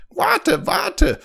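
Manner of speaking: very high squawked frog call. A narrator's reading. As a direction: call after her as a frog. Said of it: "rief der abscheuliche Frosch". (abscheulicher Frosch, hoch rufend)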